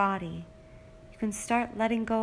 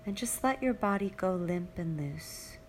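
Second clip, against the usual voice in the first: usual speaking voice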